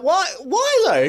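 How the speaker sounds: incredulous. Retold or imitated. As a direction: squeak it out in disbelief. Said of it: "And he's like".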